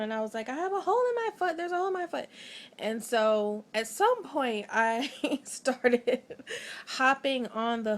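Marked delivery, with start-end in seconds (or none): 0.5-2.3 high, fast panicked cry; panicked child. Retold or imitated. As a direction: blurt it out to mom in a panic. Child panicking to her mom.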